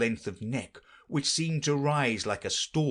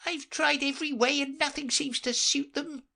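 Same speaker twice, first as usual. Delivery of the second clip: a kind of sob. Acted. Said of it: "with a kind of sob".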